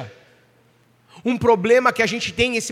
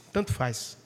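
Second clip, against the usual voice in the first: much softer, lower, giving up; resigned shrug; 9 semitones lower, 11 dB quieter, slower than usual. Pretend. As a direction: let it drop as if you've given up. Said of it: "Você fala". (resignado, bem baixo, desistindo)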